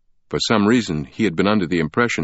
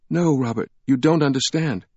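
pleading; 7 semitones higher than usual. A narrator's reading. Sienna pleading.

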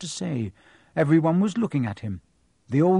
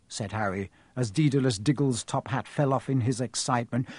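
ordinary delivery

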